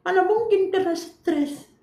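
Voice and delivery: high, slow, disbelieving patient voice; stubborn patient. Retold or imitated. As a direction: play the stubborn patient scoffing at stress. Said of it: "Jadi nanti pasien tuh bandel tuh". (pasien bandel yang tak percaya, suara tinggi dan lambat)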